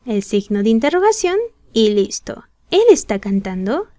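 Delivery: normal talking voice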